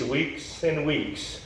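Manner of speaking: slow weary sigh. Acted. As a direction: let it fade like endless weeks. Castaway lamenting his time stranded.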